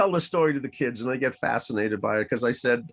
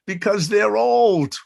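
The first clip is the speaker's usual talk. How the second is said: put-on kids' answer; 7 semitones higher, 6 dB louder, slower than usual.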